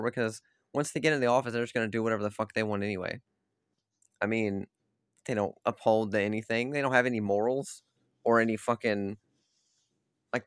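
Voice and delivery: ordinary talk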